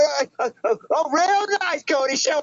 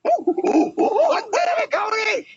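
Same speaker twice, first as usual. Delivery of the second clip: high, drawn-out frustrated yell; angry outburst; 8 semitones higher, much slower than usual. Acted. Kid yelling at rival who stole his customer, furious.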